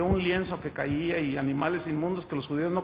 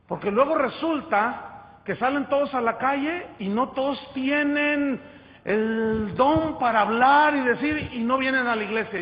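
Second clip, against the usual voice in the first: loud rebuke; 5 semitones higher, 6 dB louder than usual. Real, not acted. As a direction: hammer it home to the congregation. Preacher raising voice in rebuke.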